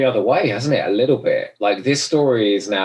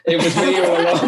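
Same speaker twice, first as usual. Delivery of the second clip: laughing through a pretend confession; mock villain confession, laughing; 7 semitones higher than usual. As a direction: reveal the twist with a giggle. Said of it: "in a twist".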